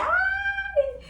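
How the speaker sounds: gritinho muito agudo de felicidade; gritinho de alegria. Shown as a demonstration. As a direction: solte um ai bem feliz. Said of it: "dar uns gritinhos assim". (gritinho alegre de comemoração)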